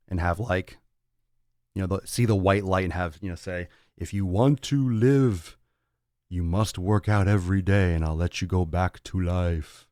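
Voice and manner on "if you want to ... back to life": slow, grand voice of the beyond; booming afterlife voice. Pretend. Near-death vision speaking to lazy friend, slow and grand.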